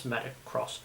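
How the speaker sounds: "Metacross", the X said as cross, softly; corrected pronunciation. Shown as a demonstration. Host recalls looking it up online, soft corrected "Metacross". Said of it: "I looked it up online".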